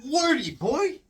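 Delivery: put-on high, soft, chirpy clean-cut kid voice; wholesome 1950s kid. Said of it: "the equivalent of "Hiya, pal".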